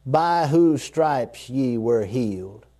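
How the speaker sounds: hushed slow reread of the promise; slow, settling repeat. Shown as a demonstration. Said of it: "Let that settle".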